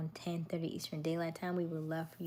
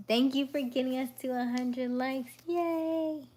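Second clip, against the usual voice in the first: bright high cheer, louder; excited thanks; 6 semitones higher, 7 dB louder than usual. Real, not acted. Streamer cheering viewers for a hundred likes, excited. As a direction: celebrate the milestone out loud.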